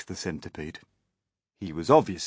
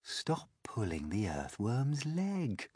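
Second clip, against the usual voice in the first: soft, slow, higher Ladybird; gentle chiding; 4 semitones higher, 8 dB quieter, slower than usual. A narrator's reading. Ladybird tells the Centipede to stop teasing, gently. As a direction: chide softly, drawing it out.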